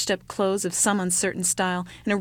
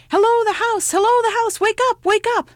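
much higher, loud hail at a door; calling out; 8 dB louder than usual. Acted. Child at the doorstep calling to wake the house, loud. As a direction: call across the snow to wake her.

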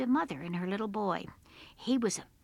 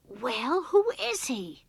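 loud, high, insistent question; impatient boy demanding to know; 4 semitones higher, 8 dB louder, slower than usual. A narrator's reading. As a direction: press mother for the answer. Boy demands answer from mother.

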